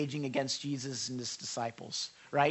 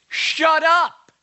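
much higher and louder; harsh shut up; 12 semitones higher, 13 dB louder than usual. Shown as a demonstration. Jesus silencing the storm, loud and harsh. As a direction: bark it at the storm. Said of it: "the word he's using is quiet".

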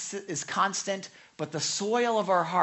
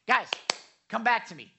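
calling for attention; 4 semitones higher, 7 dB louder, faster than usual. Retold or imitated. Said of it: "sometimes you hear me say".

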